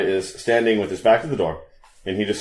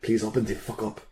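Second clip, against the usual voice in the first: hushed plea, still voiced; soft, lightly acted stage whisper; pretend whisper; 6 dB quieter than usual. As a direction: softly coax a stubborn door. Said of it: "I whisper to the door".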